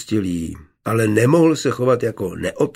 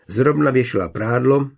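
ordinary delivery, nothing marked

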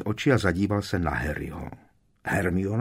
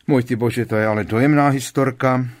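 povýšeně; 3 semitones higher, 6 dB louder than usual. Acted. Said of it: "povýšeným tónem".

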